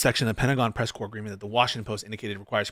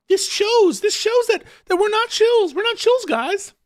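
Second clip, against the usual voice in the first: much higher, louder mocking whine; whiny mocking impression; 5 dB louder than usual. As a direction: squeak it out like a defensive shill. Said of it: "He's like".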